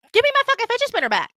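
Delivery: very high, fast outburst; rapid high-pitched tirade; furious escalated demand. Retold or imitated. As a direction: lose it demanding it back. Acted demand, escalated take.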